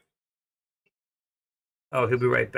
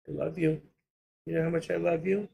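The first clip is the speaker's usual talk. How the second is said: tender pet talk; 4 semitones higher, 4 dB quieter, faster than usual. Real, not acted. Cuddling the pet.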